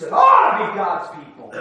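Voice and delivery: loud pulpit insistence; emphatic rebuke. Real, not acted. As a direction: press the point on the church. Preacher's pointed rebuke.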